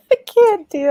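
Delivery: high, helpless laughing squeal. Real, not acted. Overcome by the put-on voice.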